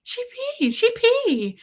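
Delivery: very high squeaky mock-French babble; French-sounding pet voice. Shown as a demonstration. Owner flipping to a different language for Gertie, squeaky French-like baby talk. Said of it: "it almost sounds French".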